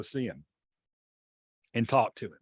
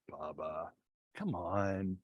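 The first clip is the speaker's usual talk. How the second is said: quiet reproach; 6 semitones lower, 10 dB quieter, slower than usual. Retold or imitated. Confronting Bubba privately about cheating.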